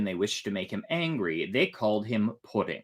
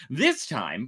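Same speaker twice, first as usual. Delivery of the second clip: usual speaking voice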